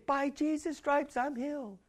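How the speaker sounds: barely audible, timid confession; really small. Retold or imitated. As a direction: say it small and unsure. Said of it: "it may come out really small".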